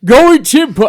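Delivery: high, loud Huddersfield burst; Huddersfield accent. Acted. Starting a pub invitation, Huddersfield accent. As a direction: boom out broad mock Huddersfield. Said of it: "we're doing the English".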